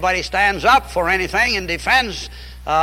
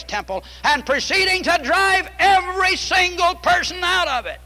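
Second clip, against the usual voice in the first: higher-pitched, ringing sermon delivery; impassioned preaching; 5 semitones higher than usual. Acted. Preacher urging the congregation on God's house of prayer, impassioned. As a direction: preach it out, stretching the key words.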